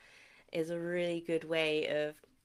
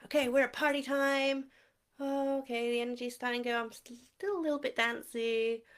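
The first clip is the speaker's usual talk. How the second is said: higher, bouncy and playful; still partying; 6 semitones higher, faster than usual. Shown as a demonstration. Walking the energy line backwards with kids, bouncy and playful. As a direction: play it like the party is winding down. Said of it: "we do it backwards".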